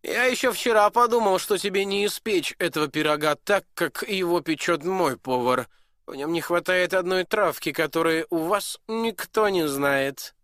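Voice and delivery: high, loud knowing prince; smug prince. Acted. Prince to the duke and dwarf, smug and loud. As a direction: lord it over them.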